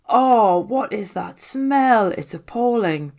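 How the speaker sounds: a loud, slow, high groan of disgust at the stench; disgusted at the smell. Acted. Marnie reacting to a rotting smell in the dark, loud and disgusted. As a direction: recoil from the reek and drag the words out. A foul smell fills the station.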